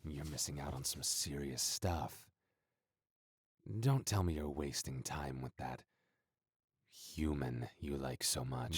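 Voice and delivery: menacing, heard faintly from another room; cold threat, offstage. Acted. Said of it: "I didn't mean for you to see me like that".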